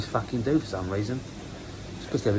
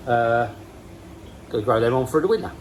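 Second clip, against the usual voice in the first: ordinary talk, nothing marked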